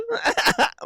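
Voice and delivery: sudden high-pitched laugh; high cackle. Real, not acted. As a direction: crack up at your own joke. Cracks up at his own bit.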